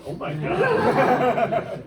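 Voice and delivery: slow, loud laughing oh my God; cracking up in amazement; loud laughing exclamation; laughing disbelief. Real, not acted.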